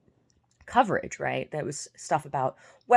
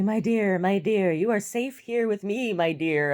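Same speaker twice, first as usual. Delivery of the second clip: louder, higher, syrupy mock endearment; mocking a smarmy suitor; 5 semitones higher, 4 dB louder than usual. Mocking the fake prince calling her dear, syrupy. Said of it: "he kept on calling me".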